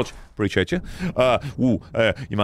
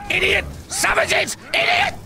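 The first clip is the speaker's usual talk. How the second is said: each insult climbs higher, ending in a squeal; rising mock insults. Mocking the dumb orcs, insults climbing to a squeal.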